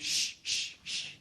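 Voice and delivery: whispered shh from the library readers; shushing. Retold or imitated. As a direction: hush him with a breathy shh. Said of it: "everybody around me went".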